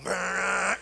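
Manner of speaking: a long, loud, rattling brrrr, mimicking the old metal sink's water pipes; loud pipe rattle. Preacher imitating the noisy pipes at the Amsterdam sink, loud rattle. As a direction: rumble it out like roaring plumbing. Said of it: "you know, really loudly".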